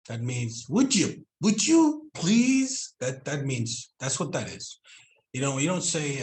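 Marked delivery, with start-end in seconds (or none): 0.7-2.9 high, loud, drawn-out plea; coaxing, stretched-out polite request; pleading. Shown as a demonstration. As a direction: beg with a long, rising "please". Demonstrating a plea for mate's meaning.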